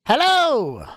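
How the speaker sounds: loud, high excited greeting; excited shout. Real, not acted. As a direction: call out hello with joy. Greeting arriving player loudly.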